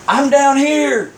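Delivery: high, drawn-out mock call. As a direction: call slowly up to someone far away. Stranded man calls up cliff.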